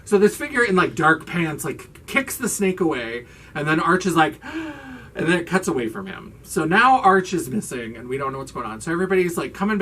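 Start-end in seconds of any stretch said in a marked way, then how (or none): 4.4-5.1 high, quiet startled gasp; acted gasp. Recapping Arch's shock after the snake, mock gasp. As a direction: suck in a startled breath. Retelling Arch's reaction to rescue.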